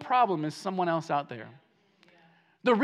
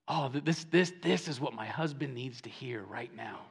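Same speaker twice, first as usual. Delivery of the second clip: low, soft aside as a listener; hushed, imitated churchgoer's thought; private thought aloud; 7 semitones lower, 8 dB quieter than usual. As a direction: think it quietly to yourself. Voicing a listener thinking of others.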